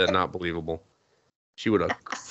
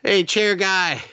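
mock high call; 10 semitones higher than usual. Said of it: "she's just like".

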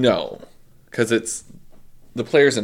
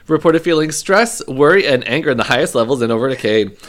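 ad read; 4 dB louder than usual. Acted. Reads a sponsor ad.

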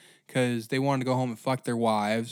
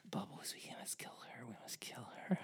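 hushed murderous whisper; conspiratorial whisper; 2 semitones lower, 19 dB quieter, faster than usual. Acted. Horse girl whispering a plot to her horse.

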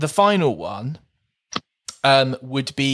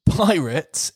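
ordinary talk, nothing marked

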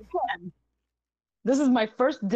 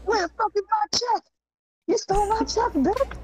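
high, mock-furious yelling voice, pitched up; imitating a furious customer; 12 semitones higher than usual. Mail carrier imitates the woman yelling through the mailbox, hammed-up high shrieking fury. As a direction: shriek the accusation like an enraged stranger. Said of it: "She flipped the fuck out".

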